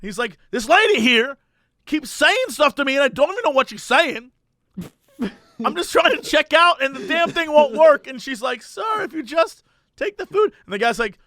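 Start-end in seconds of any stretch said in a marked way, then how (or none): 0.5-8.1 high, exasperated complaint; indignant rant, pitched up; mock shouting. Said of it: "just by shouting at people". 8.6-10.5 put-on high-pitched lady with a slight German accent; slight German accent, higher voice. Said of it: "sort of has a German accent".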